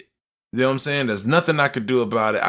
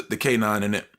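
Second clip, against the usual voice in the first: ordinary talk, nothing marked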